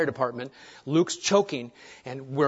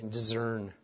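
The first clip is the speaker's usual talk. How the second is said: "discern" with a z sound, French Canadian style; French Canadian accent; 5 semitones lower, 8 dB quieter than usual. Retold or imitated. Preacher quoting French Canadian friends, French Canadian accent. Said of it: "as my French Canadian friends would say".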